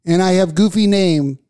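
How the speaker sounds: lampoon a Russian immigrant comic; hammed-up broken-English Russian comic; mock Russian accent. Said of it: "He's just".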